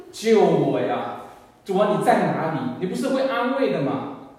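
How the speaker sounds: loud, pleading prayer shout; begging the Lord to come and comfort her; desperate cry to God. Retold or imitated. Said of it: "我的心里也在喊".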